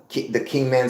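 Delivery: ordinary delivery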